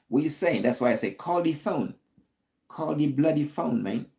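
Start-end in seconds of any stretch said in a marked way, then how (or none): 1.1-3.8 mock British accent. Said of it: "I'm trying to talk British language".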